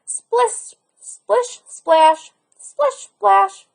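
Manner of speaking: high, excited splish splash; a boy crying out with delight; joyful cry. Acted. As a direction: shout for joy at the turtle. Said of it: "he cried".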